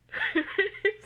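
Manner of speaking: a high loud burst of laughter. Real, not acted. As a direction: crack up at yourself. Breaks up laughing.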